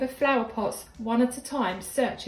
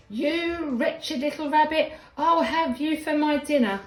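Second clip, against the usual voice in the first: lightly voiced cross gardener; Mister McGregor growling; 5 semitones higher, 4 dB louder than usual. A narrator's reading. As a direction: draw out the threat in mock menace. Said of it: "growled Mister McGregor".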